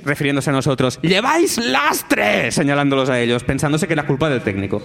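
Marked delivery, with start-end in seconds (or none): 1.0-2.5 high, slow shout. Retold or imitated. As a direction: yell it across to the sound guy. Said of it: "les grita al técnico".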